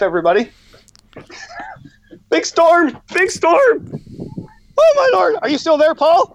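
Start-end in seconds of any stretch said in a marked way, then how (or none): 2.3-6.3 much higher, hurried and flustered; real alarm as the storm arrives, voice up high; flustered excitement. Real, not acted. Guest caught in a storm, calling out to host Paul in alarm. Storm hits during live podcast.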